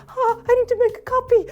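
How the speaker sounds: high, shaky retold panic; frightened plea. Shaking boy clutching the disc, high and frightened. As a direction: squeak it like a shaking kid. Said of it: "shaking, going".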